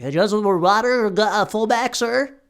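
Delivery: high, loud, put-on water boy voice. Water boy asking a player about water, high put-on voice. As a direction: pipe up like an over-eager water boy, pitch climbing. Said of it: "like a water boy or some shit".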